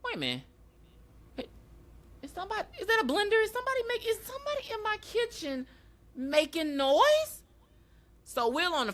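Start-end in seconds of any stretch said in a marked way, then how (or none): 0.0-7.4 high, hammed-up clown asking who's in the kitchen; mock Pennywise voice. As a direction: squeal it like a creepy clown caught off guard. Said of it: "he like".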